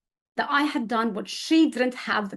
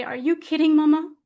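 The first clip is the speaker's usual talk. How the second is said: higher, louder, fond disbelief; smiling reassurance; 5 semitones higher, 5 dB louder than usual. Retold or imitated. To dying mother who feels a burden, smiling reassurance. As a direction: laugh off her worry, tenderly. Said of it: "I smile at her and I say".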